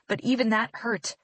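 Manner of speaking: plain reading voice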